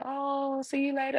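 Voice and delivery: high, sing-out mock goodbye; well-wishers' farewell. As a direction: imitate others calling a high-pitched goodbye. Inmates' farewells imitated.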